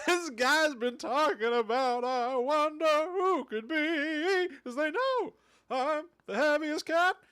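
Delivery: high, loud, drawn-out performance; hammed-up high yelling voice; loud comic character. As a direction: belt it out slowly and high. Acting out comic voices at length.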